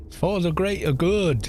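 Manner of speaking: exaggerated West Country Somerset chant; Somerset accent. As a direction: imitate a Somerset villager, drawn out. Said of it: "the Somerset accent".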